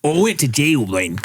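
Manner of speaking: put-on accented comedian voice; hammed-up accented impersonation; Nanette impression. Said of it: "Can you do Nanette then?".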